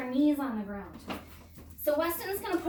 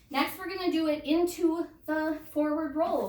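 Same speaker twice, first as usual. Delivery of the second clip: ordinary delivery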